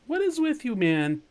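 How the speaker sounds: high-pitched, exasperated squawk. Real, not acted. Gamer to pestering dinosaur, exasperated.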